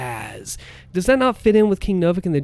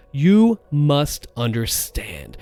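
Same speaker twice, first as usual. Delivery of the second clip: slow, weighty "You must understand"; authoritative declaration; much slower than usual. Acted. Voice actor imitating the character's change of tone, slow and authoritative. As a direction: drive home authority, word by word. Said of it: "And then his tone changes".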